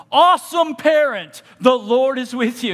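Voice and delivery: booming heavenly shout-out; shouted proclamation. Acted. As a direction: shout it across the field like good news. God shouting out to you.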